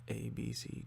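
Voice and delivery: burped letters; burping the words. Shown as a demonstration. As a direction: burp out each letter. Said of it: "burping the alphabet".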